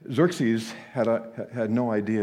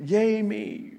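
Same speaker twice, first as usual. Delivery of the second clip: high, loud smug delight; gleeful cheer; 9 semitones higher, 4 dB louder than usual. Acted. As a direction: cheer for yourself. Haman's self-congratulation.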